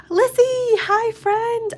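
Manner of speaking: sing-song high welcome; excited greeting. Real, not acted. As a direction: call out happily to a friend. Greeting a viewer.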